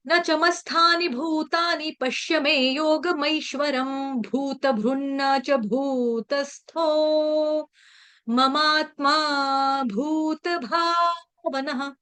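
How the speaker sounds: slow, measured Sanskrit chanting; shloka recitation. Shown as a demonstration. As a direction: recite each syllable slowly and clearly. (धीमा, स्पष्ट श्लोक पाठ)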